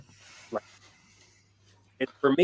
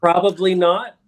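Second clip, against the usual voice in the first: louder, higher dry refusal; mock-firm probably not; 10 semitones higher, 8 dB louder, slower than usual. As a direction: turn it down with a laugh. Retold refusal to present.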